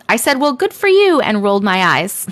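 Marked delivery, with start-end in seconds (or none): 0.4-1.2 higher, mock-impressed jab; sarcastic retort. Child answering an auntie who ate the biscuits, sarcastic retort. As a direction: toss it off as a pointed retort. Acts own retort from story.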